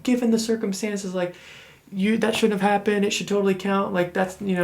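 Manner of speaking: high sympathetic coworker voice; imitating coworkers. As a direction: voice them high and pitying.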